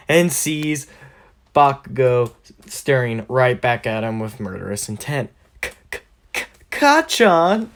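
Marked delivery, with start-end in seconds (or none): 5.6-7.7 stutter, then a high rising yelp; nervous stammer. Acted. Deku bumps into a glaring Bakugo, nervous high stammer. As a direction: stammer the start, then squeak the name up.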